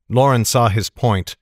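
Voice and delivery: usual speaking voice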